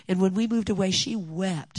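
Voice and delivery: ordinary talk, nothing marked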